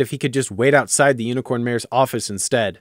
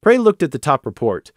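plain reading voice

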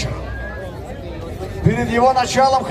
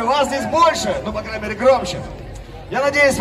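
normal talking voice

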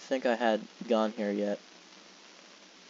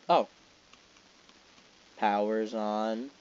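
usual speaking voice

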